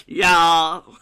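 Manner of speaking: loud, hammed-up American "y'all"; American accent. Shown as a demonstration. Streamer cracking himself up, loud put-on American accent. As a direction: belt it out as a mock American Southerner. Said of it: "How's my American accent".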